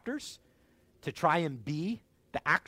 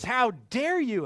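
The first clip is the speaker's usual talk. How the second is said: slow, high and pointed; slow indignation; 8 semitones higher, 6 dB louder, slower than usual. Retold or imitated. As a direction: lean on each word, offended. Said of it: "And he goes".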